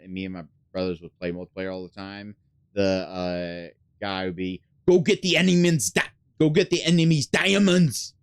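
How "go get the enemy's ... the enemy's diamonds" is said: put-on loud, high Austrian-accent announcer shout; Schwarzenegger impression. Gamer imitating the Far Cry announcer in Austrian accent. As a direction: bark the order in a mock Austrian accent. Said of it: "I sound like Schwarzenegger".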